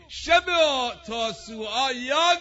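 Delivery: sudden high loud cry; preaching shout. Real, not acted. Preacher urging men and women, rising to a loud cry. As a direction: call out to the whole hall.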